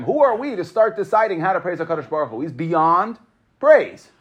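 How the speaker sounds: normal talking voice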